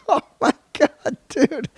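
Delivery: high-pitched, helpless laughter through the words; words squeezed out high through real laughter; laughing. Real, not acted. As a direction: crack up while talking. Cracking up at his own story.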